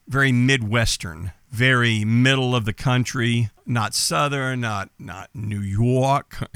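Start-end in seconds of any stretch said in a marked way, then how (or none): 5.3-6.5 slow, drawn-out mock New York accent. Shown as a demonstration. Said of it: "that kind of a sound".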